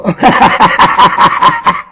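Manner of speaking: voiceless wheezing laugh; breathless laugh. Real, not acted. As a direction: laugh out on air, no voice. Laughing at rival.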